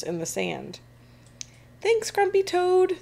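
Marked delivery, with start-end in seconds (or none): 1.8-3.0 high, level group shout; happy shout. A narrator's reading. Happy crew to toad sharing treasure, shouting. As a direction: call out thanks like a whole crew. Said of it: "they shout".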